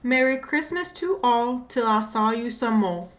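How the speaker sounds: put-on light Caribbean accent reading. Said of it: "I don't try to do a Caribbean accent".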